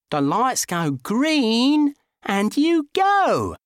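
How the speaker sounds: very slow, high, emphatic spelling-out; insistent explaining. A narrator's reading. Driver lectures friend on the road code, insistent. Arguing about code of the roads.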